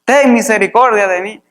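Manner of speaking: high-pitched calling out, easing at the end; acted cry. Preacher voicing blind Bartimeo calling to Jesus, acted cry. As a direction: call out for mercy, imitating a shout. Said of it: "Bartimeo dice que gritaba".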